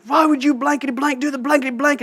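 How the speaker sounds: high, mock furious yell at a small kid; hammed-up bully yelling; imitating an angry yell. Big kid yelling at the boy under him, high mock fury. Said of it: "but he was saying".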